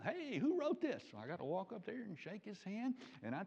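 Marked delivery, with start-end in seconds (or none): 0.0-1.0 high-pitched retold yell. Cellist looks at a gospel tract, retold high yell. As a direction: call out a question across the room, imitating a yell. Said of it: "he yelled".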